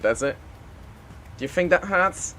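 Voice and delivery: ordinary talk, nothing marked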